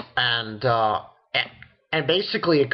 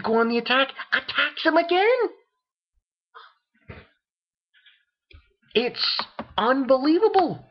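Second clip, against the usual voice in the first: excited; 12 semitones higher than usual. Real, not acted. Excited recap of a surprise attack.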